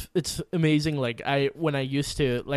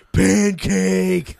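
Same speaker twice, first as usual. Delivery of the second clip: put-on loud, excited toddler voice; toddler shout; 5 semitones higher, 8 dB louder than usual. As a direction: belt it like a little kid. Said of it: "Like that".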